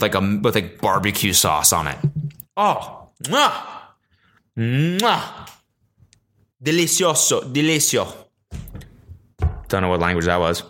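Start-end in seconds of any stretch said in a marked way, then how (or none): 2.6-5.5 smack kisses off the fingertips; chef's kiss. Pretend. 6.6-8.1 hammy Italian chef, higher; Italian accent. Pretend. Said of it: "doing the Italian thing".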